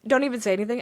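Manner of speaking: normal talking voice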